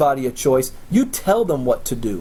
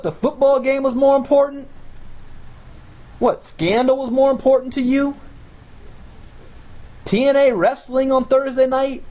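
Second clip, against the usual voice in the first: put-on higher, outraged child scolding a parent; indignant kid; 8 semitones higher than usual. Child scolding dad for skipping the meeting, high indignant voice. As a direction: play a kid calling out dad, spluttering what what what. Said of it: "I'd be like".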